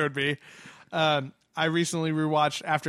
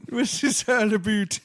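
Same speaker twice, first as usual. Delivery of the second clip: much higher and louder puzzled voice; mock Canadian listener, louder; 7 semitones higher, 6 dB louder than usual. Host echoing the Canadian listener voice, louder and higher. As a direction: echo it louder as a baffled fan. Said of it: "She's like".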